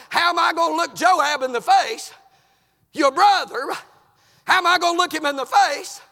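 high, loud anguished appeal; impassioned plea. Acted. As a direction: plead at full preaching force. Acted plea to pursuer.

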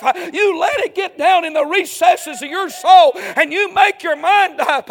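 high, loud shouting sermon climax; fiery preaching. Real, not acted. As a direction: preach it out at full force. Preacher at full force, climax.

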